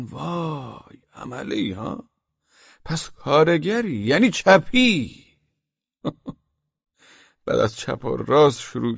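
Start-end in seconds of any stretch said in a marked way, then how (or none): 0.0-5.2 suspicious mock realization. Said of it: "گفتن".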